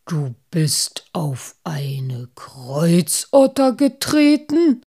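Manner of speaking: stern, incredulous interrogation. A narrator's reading. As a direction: draw it out severely, then jump up on the last word. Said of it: "Die Stimme Moosbarts wurde jetzt streng".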